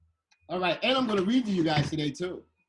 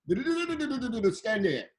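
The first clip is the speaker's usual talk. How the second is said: higher, rapid mock-foreign babble into English; imitated foreign chatter; 6 semitones higher than usual. French TV crew directing a visiting dancer, mock-foreign gibberish. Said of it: "they can only speak English for".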